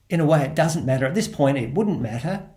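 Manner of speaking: ordinary talk, nothing marked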